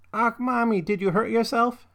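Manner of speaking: put-on high-pitched little child's voice; a small girl asking her mother; toddler Hilda. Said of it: "Hilda is a toddler".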